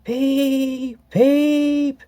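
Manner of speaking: very high eerie whistle shrieks; ghostly shriek. A narrator's reading. Percy pretending to be a ghost, shrieking high. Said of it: "he shrieked".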